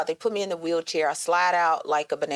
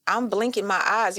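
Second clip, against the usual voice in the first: ordinary talk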